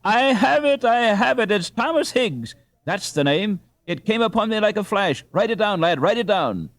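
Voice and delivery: like a name just struck him in a flash; excited eureka. A narrator's reading. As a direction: Ralph urging the lad to write the name down. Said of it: "he cried".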